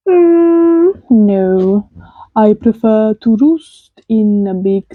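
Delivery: slow Transylvanian owl voice; Transylvanian accent. Acted. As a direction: roll it out like a Transylvanian count. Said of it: "I like your Transylvanian accent tonight".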